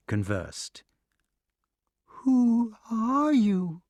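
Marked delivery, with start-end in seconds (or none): 2.0-3.8 high, slow, timid question; trembling snake. A narrator's reading. Startled snake asks the newcomer, high and trembling. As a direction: ask it drawn out and fearful. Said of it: "asked the snake, trembling".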